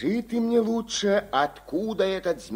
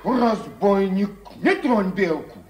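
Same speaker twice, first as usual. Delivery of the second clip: shouting; 2 semitones higher, 9 dB louder, faster than usual. Acted. Soldier shouts at serpent.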